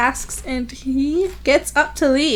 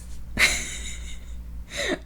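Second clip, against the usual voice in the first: laughing; 12 semitones higher, 11 dB quieter than usual. Real, not acted. Amused laugh before quote.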